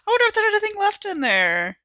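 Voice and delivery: high, loud and eager over the bar; eager wondering. Acted. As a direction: perk up at the thought of a drink. Gigi eyeing the bar.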